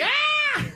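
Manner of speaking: high-pitched scream of fright, drawn out; terrified scream. Acted. Lion showing the giraffe how the dude looks, mock terrified scream. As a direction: mimic a person screaming in terror.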